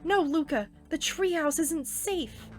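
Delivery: shouted, panicked warning; urgent warning. Acted. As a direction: yell to stop a friend.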